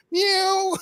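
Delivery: squeaky very high kitty meow; cat meow. Pretend. The cat in the photo greeting the boyfriend, a very high mock meow. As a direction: voice the dead cat like a cute kitten. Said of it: "looking at him like".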